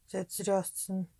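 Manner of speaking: usual speaking voice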